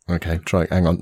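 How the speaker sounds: ordinary delivery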